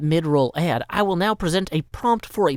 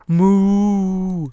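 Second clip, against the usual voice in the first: cow moo; 5 semitones higher, 7 dB louder than usual. Pretend. Making animal noises for timer.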